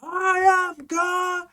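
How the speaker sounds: long, high-pitched imitated scream; mock scream. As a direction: scream it out drawn-out and very loud, mocking. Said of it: "He, he must have been screaming".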